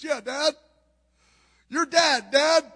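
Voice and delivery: confused son. Retold or imitated. Confused son answers father.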